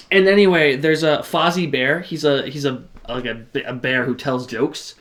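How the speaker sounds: ordinary delivery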